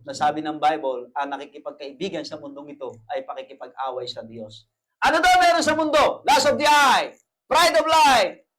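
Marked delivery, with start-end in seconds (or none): 5.0-8.5 loud, high, slowed sermon cry; full-force preaching. Acted. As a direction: preach it out to the congregation.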